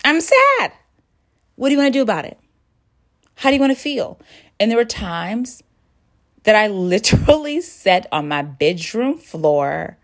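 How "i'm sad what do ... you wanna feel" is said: much higher, tender questioning of herself; gentle self-talk. Retold or imitated. Talking to herself on the bedroom floor, high and gentle. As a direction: coax yourself kindly through the grief. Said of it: "I said".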